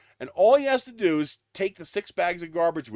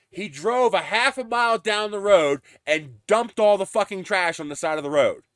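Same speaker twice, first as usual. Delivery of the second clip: louder, higher, outraged telling; indignant; 5 semitones higher, 6 dB louder than usual. Real, not acted. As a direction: let the outrage spill out. Angry retelling of dumped trash.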